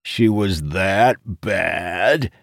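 prying tease. A narrator's reading. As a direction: draw it out, digging for gossip. Friend pries teasingly about a liaison.

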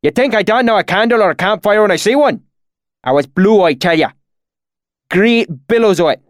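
high, loud, indignant country dialect; heated rustic swineherd. A narrator's reading. Swineherd defending what he saw, heated and rustic. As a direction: argue like an affronted bumpkin.

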